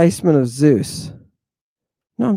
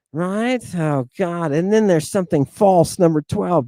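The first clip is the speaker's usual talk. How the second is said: ordinary talk, nothing marked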